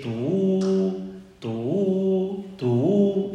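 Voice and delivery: long held tu notes, slow; slow sustained groaning notes; drawn-out shofar notes. Shown as a demonstration. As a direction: stretch each tu out like a moan. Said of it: "Y hay otro sonido".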